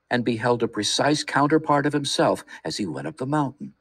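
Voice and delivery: usual speaking voice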